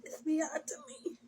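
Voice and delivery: crying whisper, nearly no voice; whispered sob. Real, not acted. Woman breaking down to viewers, barely audible crying whisper. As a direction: let it slip out through a sob. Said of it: "Maybe that's why I crying now".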